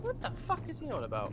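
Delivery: sudden high disbelieving squawk; baffled. Retold or imitated. New phone shop worker baffled by a screaming customer, high disbelief. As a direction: pitch it way up in stunned disbelief.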